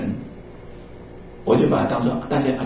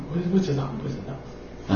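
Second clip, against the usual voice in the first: a soft unvoiced whisper to himself; whispered aside; 11 dB quieter than usual. Real, not acted. Teacher sighing over beings, resigned whisper. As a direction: let it fade into a whisper.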